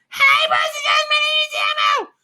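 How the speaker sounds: very high, loud puppet squeal; shouted falsetto children's character, pushed to the top; Elmo. Acted. Streamer showing how high he can get, shrieky Elmo voice. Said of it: "it's just a really high-pitched voice".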